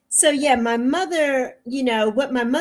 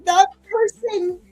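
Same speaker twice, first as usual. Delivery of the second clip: real crying, much higher, voice breaking; 7 semitones higher than usual. Real, not acted. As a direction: sob out the hurt about her mother. Hurt by attacks on her mother.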